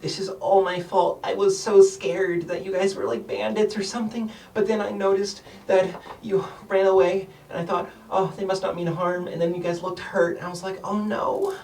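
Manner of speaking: high, rambling little voice full of remorse; a tiny girl confessing she was scared; guilty gnome girl. Acted. Gnome girl explaining her fear.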